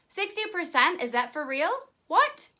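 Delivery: high-pitched mock astonishment, leaping up on "What"; playful disbelief. Seller marvels at sixty percent commission for viewers, playfully incredulous.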